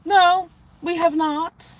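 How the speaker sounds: high, loud exasperated comeback; indignant retort. Acted. Answering the smug space question, loud and indignant. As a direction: fire it back, high and loud.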